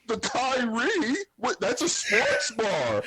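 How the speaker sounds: high, drawn-out mock disbelief; mocking put-on voice. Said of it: "why you do your voice like that".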